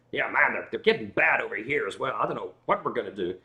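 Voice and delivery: a hint of a Texan grumbling about gas prices; Texan officer. The retold officer complains about gas prices, light Texan accent. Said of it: "he's like".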